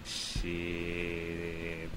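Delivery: softly drawn-out, relishing curse; savouring. Retold or imitated. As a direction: stretch the word out low and soft. Savouring the pleasure he retells.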